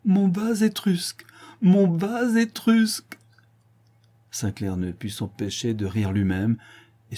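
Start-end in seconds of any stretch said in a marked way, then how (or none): 0.1-3.2 high, slow, laughing cry; laughing exclamation. A narrator's reading. Countess laughing at Sinclair's jealousy, high laughing cry. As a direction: burst out laughing over the words. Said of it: "elle s'écria en riant aux éclats".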